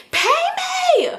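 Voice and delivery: very high mock scream; imitating a high-pitched screamed demand; retold scream. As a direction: screech it like a furious friend. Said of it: "She just kept screaming".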